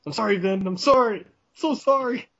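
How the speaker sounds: hammed-up sorry, voice rising each time; whiny Paul Walker. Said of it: "But he didn't speak a lot".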